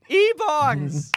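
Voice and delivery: high laughing exclamation; delighted squeal. Real, not acted.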